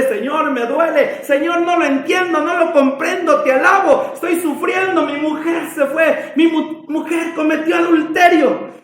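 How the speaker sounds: high-pitched, impassioned lament to God; pleading, pained outpouring; anguished prayer. Acted. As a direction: cry out your hurt in prayer. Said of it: "a llorar, a decirle".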